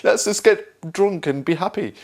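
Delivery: mock the friend who just wants a drink; squeaky, tipsy let's-party voice; carefree party-goer. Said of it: "And you're like".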